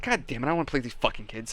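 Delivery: annoyed, fast and soft mutter under the breath. Retold or imitated. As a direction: grumble it quickly, fed up. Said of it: "and I was annoyed".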